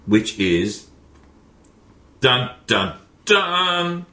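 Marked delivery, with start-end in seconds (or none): 2.2-4.1 sung dun-dun fanfare, then a high, drawn-out title; mock show announcer. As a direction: announce the segment like a playful game-show host. Mock fanfare announcing a podcast segment.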